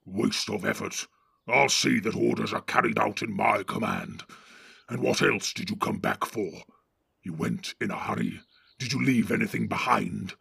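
gruff orc chief giving orders; Ugluk the orc captain. Acted. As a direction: bark orders at the cowardly Northerners. Ugluk taunts.

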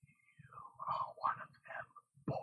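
rasping whisper like a file on bone; hoarse whisper. A narrator's reading. The eyeless Fade cornering Rand, hoarse whisper. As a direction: menace him in a dry, rasping whisper. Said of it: "a hoarse whisper like a file".

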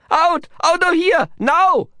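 loud, very high panicked cries; hysterical whine. A narrator's reading. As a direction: scream to escape, voice at the top of its range. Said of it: "his voice rising to a hysterical whine".

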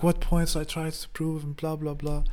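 high, mocking imitation of the roommate; roommate's protest, imitated high. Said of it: "And he's like".

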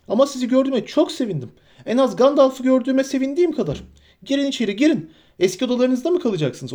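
high, excitable, bustling voice; fussy host hurrying from one thought to the next; flustered, chattering innkeeper. A narrator's reading. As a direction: rattle on in a high-pitched fluster. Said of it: "hala eski nefes nefese telaşı içindeydi".

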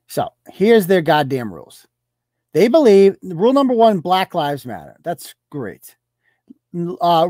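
ordinary talk

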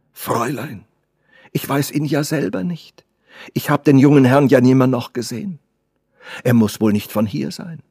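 lightly voiced earnest old servant; old servant protesting. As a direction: protest innocence to the young mistress. Old servant answers the girl.

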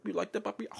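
high, soft teasing coo; mock seduction. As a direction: coo it softly like a put-on come-on. Acting out her sexual talk.

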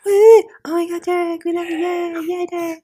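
high, loud crowd cheering. Pretend. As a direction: scream like adoring fans. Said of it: "the crowd goes wild".

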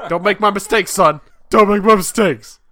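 loud, high melodramatic warning; pleading father. Acted. Father warning son off his own mistakes, mock-tragic plea. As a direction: implore him with mounting mock desperation. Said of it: "a father that was at the party".

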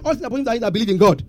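quick throwaway misquote; fast dismissive misquote; rushed mock quote. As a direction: rattle it off fast as the wrong version. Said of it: "He never said".

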